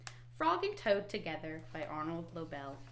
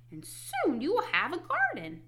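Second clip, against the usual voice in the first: high, encouraging frog; high storybook Frog; Frog character voice; 9 semitones higher, 4 dB louder than usual. A narrator's reading. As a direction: promise it in Frog's high voice. Frog giving seeds to Toad.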